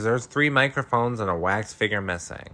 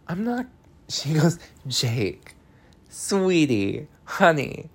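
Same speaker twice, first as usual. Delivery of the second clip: sweetly menacing; 6 semitones higher than usual. Retold or imitated. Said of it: "And Joanie goes".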